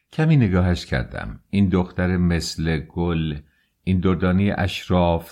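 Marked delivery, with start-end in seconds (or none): none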